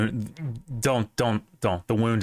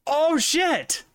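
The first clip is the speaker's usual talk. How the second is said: sudden high startled cry; surprised. Real, not acted. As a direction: blurt it out in shock. Sudden realization about the spelling.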